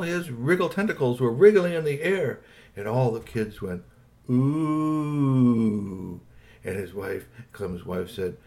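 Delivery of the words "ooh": long drawn-out ooh of amazement; awed ooh. A narrator's reading. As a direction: draw it out like wondering kids. Kids amazed by wriggling tentacles.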